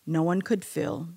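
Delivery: normal talking voice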